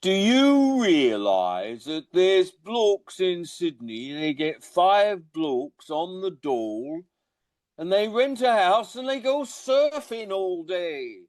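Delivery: imitating his Coventry stepdad with a high, slow Midlands accent; Coventry stepdad impression. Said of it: "me and my brothers got very good".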